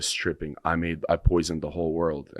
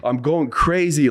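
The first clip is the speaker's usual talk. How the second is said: higher, louder and slow, panicked; frantic retelling; 10 semitones higher, 7 dB louder, slower than usual. Retold or imitated. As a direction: relive the panic of losing it. Reliving his panic in the story.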